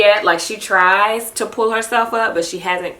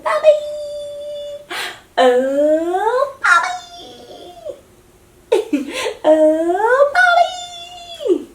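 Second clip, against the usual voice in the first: much higher cooing sounds; much slower than usual. Real, not acted. Mother coos at her baby with playful sounds.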